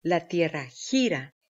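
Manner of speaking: ordinary talk, nothing marked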